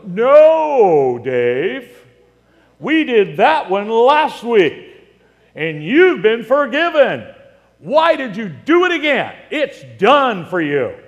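booming, hammed-up divine rebuke; loud, high, drawn-out put-on reprimand; mock scolding. God refusing to forgive Dave a second time, mock scolding.

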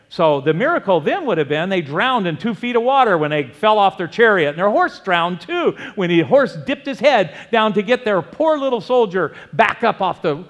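loud, fired-up sarcastic preaching; mocking preaching. Real, not acted. Preacher mocking the sceptics loudly to the congregation.

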